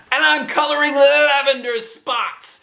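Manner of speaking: put-on a girl joining in; eager girl. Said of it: "said Charlotte".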